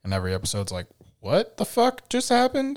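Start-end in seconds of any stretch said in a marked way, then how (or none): 1.2-2.8 high-pitched stunned reaction; much higher, incredulous retelling; mock disbelief. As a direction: act out being baffled by a plot twist. Acting out reaction to plot twists.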